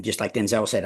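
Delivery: ordinary talk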